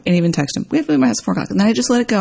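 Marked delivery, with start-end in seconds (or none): 0.7-1.5 nagging mom voice. Retold or imitated. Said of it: "getting into that mommy mode".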